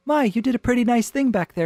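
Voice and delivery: high, quick, prim compliment; polite small talk. Acted. Woman complimenting the man she secretly flirts with, lightly innocent. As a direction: lift it high as an innocent remark. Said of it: "nothing on the surface going on here".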